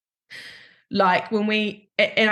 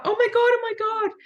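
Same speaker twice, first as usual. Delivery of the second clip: panicked cry, pitched much higher; reliving the moment of shock, high panic; retold freak-out; 13 semitones higher than usual. Owner realising her dog has died in her arms, high panicked cry. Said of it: "And then I freaked out".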